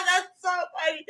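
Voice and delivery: high, giggly through laughter; laughing. Real, not acted. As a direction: squeal it while laughing. Still laughing at the line.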